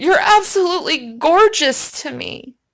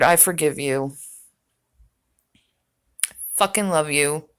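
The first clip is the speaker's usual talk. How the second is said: softer and lower, mock-soothing forgiveness; mock tender; 11 semitones lower, 5 dB quieter than usual. Mock consoling the man in clip.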